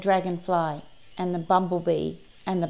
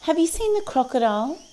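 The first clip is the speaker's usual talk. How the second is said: high-pitched storybook animal voice; high creature question; inquiring little creatures; 10 semitones higher than usual. A narrator's reading. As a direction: ask it high, as parrot and friends. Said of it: "asked the parrot and the dragonfly".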